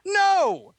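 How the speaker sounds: very high, very loud shout. Acted. Son shouts no at his departing father. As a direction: yell it out at full force. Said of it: "Jacob shouted".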